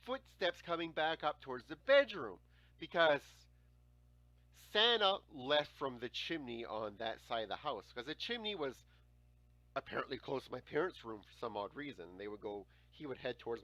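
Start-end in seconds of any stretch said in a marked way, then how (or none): none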